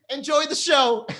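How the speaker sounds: high, slow sarcastic sweetness; mock sweet. Comic after mock beating a fan, high sarcastic sweetness. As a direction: sing it out sweetly. Ironic sign-off after skit.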